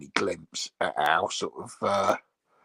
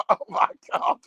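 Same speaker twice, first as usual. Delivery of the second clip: high, loud gasp of a horrified partner; mock horror; 13 semitones higher, 5 dB louder than usual. Partner seeing the Yuletide log, mock horrified. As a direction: imitate an appalled partner's reaction.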